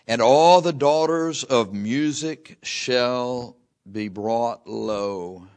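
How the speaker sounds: preacher intoning scripture; preaching scripture. Acted. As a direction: proclaim the verse slowly.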